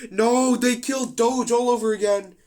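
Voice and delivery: high, loud disbelieving protest; outraged cry. Real, not acted. Commentator protesting as Doge dies again, high and loud. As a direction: wail it like your favourite just got killed.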